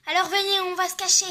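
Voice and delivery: high, loud urgent call; urgent fear. Acted. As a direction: hurry them in fear.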